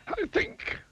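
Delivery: very high-pitched wizard voice; musing wizard. A narrator's reading. Wizard talking to himself, musing. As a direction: muse in a high character voice. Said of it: "he said to himself".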